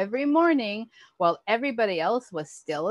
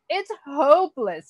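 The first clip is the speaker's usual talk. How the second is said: higher, louder despairing cry; 6 semitones higher, 5 dB louder than usual. A narrator's reading. Walter cries out in despair at the dough. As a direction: give up in despair. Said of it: "he cried".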